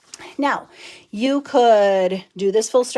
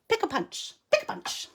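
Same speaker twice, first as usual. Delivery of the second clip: really fast; 12 semitones higher, 6 dB quieter than usual. Shown as a demonstration. Crafter showing viewers how to say the punch name, rattled off fast. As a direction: rattle off Pick a punch, pick a punch as a quick tongue twister. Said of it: "like, really fast".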